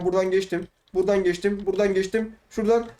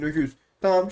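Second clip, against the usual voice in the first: ordinary talk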